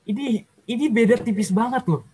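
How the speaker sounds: high-pitched retold amazement to God; awed disbelief. Telling God of the narrow margin, in high-pitched awe. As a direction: tell God in a high, incredulous voice.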